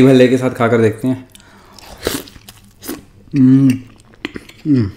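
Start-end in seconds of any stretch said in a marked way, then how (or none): 3.3-4.9 humming with closed full mouth; muffled mid-bite sounds; mouth full, chewing. Real, not acted. Seen 0: Lips pressed, cheeks full, chewing bhalla just put in mouth.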